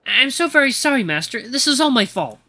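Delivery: a touch of grovelling servant; anxious apology. Acted. Trembling butler takes the blame before his master, anxiously apologetic.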